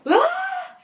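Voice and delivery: imitating a high piercing scream; retold scream. Recalling the scream with her brother in the dark, high shriek. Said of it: "Me and this being are screaming".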